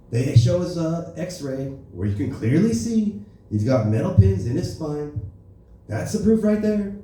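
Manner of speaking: Trump gossiping smugly; Trump impression. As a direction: Trump rambling with a sly grin. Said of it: "here's Trump getting shot".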